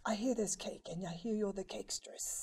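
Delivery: hushed, whispered bad British accent; mock British accent. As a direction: singer asking about the cake, in a self-mocking British accent. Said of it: "forgive my horrible British impersonation".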